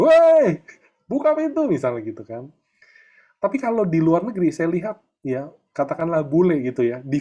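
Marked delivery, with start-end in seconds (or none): 0.1-1.7 sudden very loud, high yell at a closed door; bang-on-the-door shout, much higher and louder; parent yelling. Retold or imitated. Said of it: "digedor-gedor".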